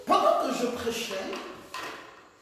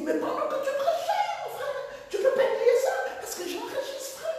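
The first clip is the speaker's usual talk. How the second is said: very high laughing mock voice; small voice; 4 dB louder, faster than usual. Pastor claiming he recorded the sermon, very high mocking voice. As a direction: squeak it out like the indignant pastor, laughing. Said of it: "Il avait une petite voix".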